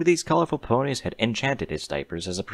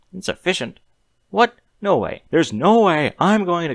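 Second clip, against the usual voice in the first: high, rising panic; panicked disbelief; 9 semitones higher, 5 dB louder than usual. A narrator's reading. Human backing away from the guards, panicking high. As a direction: voice climbs in alarm.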